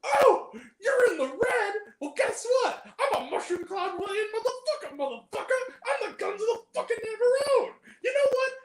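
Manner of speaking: high-pitched, hammed-up tirade; theatrical movie-quote outrage; mock indignant rant. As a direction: rant high, on the edge of laughing. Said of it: "He goes".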